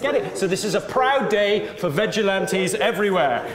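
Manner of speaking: normal talking voice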